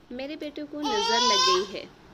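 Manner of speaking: distressed cry. Acted. Mother worried about her son.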